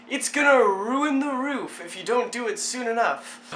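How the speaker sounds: a hint of a warning dad. Dad warning his child about the roof, mild nagging. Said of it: "my dad was like".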